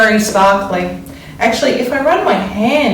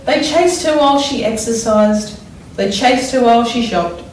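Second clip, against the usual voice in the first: put-on expressive read-aloud for children; story reading; 4 semitones higher than usual. A narrator's reading. Teacher reads picture book aloud.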